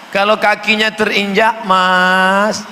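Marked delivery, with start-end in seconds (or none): 1.6-2.7 polite Sundanese sorry, drawn out; gentle Sundanese. Pretend. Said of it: "Orang Sunda ini lembut". (permisi lembut logat Sunda, pelan)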